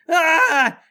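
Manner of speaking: loud, high scream; crying out. Acted. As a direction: cry out amid the chaos. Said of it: "You hear someone cry out".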